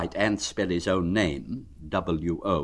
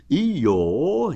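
louder, solemn bear; 7 semitones higher, 4 dB louder than usual. Acted. Pooh solemnly addressing Eeyore. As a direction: announce it gravely like a vow. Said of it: "he said solemnly".

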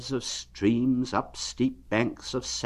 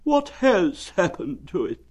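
gloomy Eeyore; 10 semitones higher than usual. Acted. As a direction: drag it out like a sad old donkey. Eeyore asks.

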